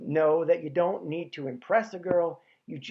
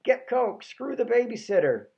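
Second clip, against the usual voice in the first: high-pitched urging inner voice; high little voice egging someone on; 6 semitones higher than usual. Acted. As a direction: pipe up as the tempting little voice in the head. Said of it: "hearing a little voice going".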